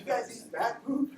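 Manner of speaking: high, slow, laughing through it; laughing disbelief. Retold or imitated. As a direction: ask it cracking up, high. Retold question, laughing.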